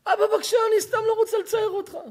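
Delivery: high, hurried pleading; tearful child's quick, high-pitched plea; acted crying daughter. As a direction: plead with father through mock tears. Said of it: "את בוכה, חיים שלי".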